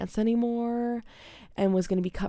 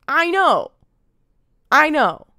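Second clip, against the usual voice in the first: loud, strained I know, said twice; a loud, fed-up retold reply; exasperated admission; 8 semitones higher, 12 dB louder, slower than usual. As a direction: blurt it out, defeated and loud. Retold admitting to a harsh teacher.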